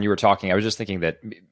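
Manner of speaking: normal talking voice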